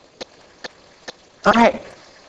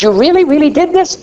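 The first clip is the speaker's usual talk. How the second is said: much higher, amazed question rising at the end; incredulous wife; 9 semitones higher than usual. Retold or imitated. Wife asking her husband once more, incredulous. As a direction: act out her disbelief, leaning on "really, really". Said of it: "She says".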